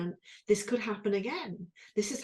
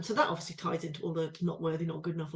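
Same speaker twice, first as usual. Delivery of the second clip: usual speaking voice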